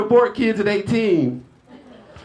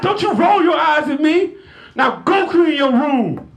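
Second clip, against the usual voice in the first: raised, higher scolding mom voice; mock angry parent; 8 semitones higher than usual. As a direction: snap at the eye-rolling daughter. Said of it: "and I'll be hot".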